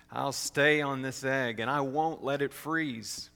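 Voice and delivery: ordinary delivery, nothing marked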